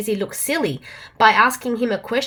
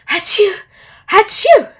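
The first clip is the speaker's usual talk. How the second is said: higher, louder fake sneezes; mock sneezing; 10 semitones higher, 9 dB louder than usual. Pig repeats the riddle, with mock sneezes. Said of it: "repeated the pig".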